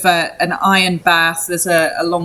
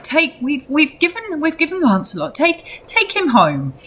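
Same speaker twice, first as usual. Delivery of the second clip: kindly burgomaster; 9 semitones higher than usual. Acted. Burgomaster soothing distraught mother.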